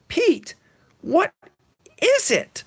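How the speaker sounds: very high mock woman's voice; squeaky worried wife; wife's falsetto. Wife asking her shaken husband, high falsetto. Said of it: "his lovely lady says".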